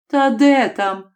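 ordinary delivery, nothing marked

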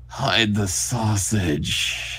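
very slow, drawn-out creep; lingering, hammed-up predator voice; creepy groomer. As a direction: ooze the words out slowly. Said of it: "This is a groomer".

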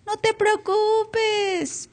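very high, slow, sing-song "No te preocupes"; mock reassurance. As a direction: lampoon a friend's useless comfort. Mimics friends' reassurance.

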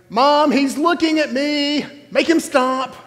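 put-on high, loud whiny kid yelling; whiny tattling little sibling. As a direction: yell like a tattling child in the car. Kid yelling from back seat.

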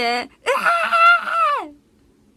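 huge high laughing scream; shout on request. Pretend. Viewer asks her to shout, big laughing yell. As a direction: let out a big whooping yell, then laugh. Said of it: "叫んで".

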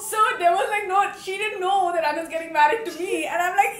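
loud, high laughing narration; loud laughter. Real, not acted. Bride laughs retelling her friend's phone call. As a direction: laugh hard through the story.

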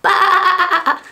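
high playful baa; sheep bleat. Pretend. Teacher bleating for toddlers, high and playful. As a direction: bleat like a lamb for the kids.

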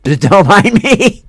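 slow, laughing through the words; high, loud giggling apology; nervous laugh. Real, not acted. Laughing off own fear.

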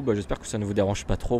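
ordinary talk

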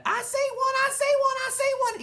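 very high, loud thrilled shout; shouting through a hand. Acted. Sheldon shouting through Junie B's hand, thrilled shout. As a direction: shout it right through the palm. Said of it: "he shouted".

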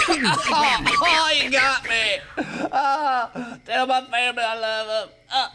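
hammy fake dying. Fake dying after joke.

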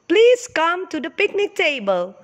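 calling out. Acted. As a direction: call the children to lunch. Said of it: "called a mother and father nearby".

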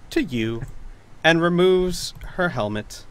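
usual speaking voice

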